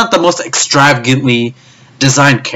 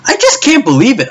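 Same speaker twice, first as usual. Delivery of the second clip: exasperated; 11 semitones higher than usual. Real, not acted. High exasperation.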